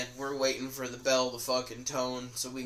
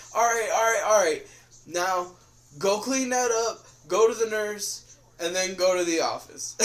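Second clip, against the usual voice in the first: lightly voiced teacher, higher and louder; a hint of a teacher sending him off; 7 semitones higher, 5 dB louder than usual. Teacher telling the student to go to the office, lightly voiced. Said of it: "he's like".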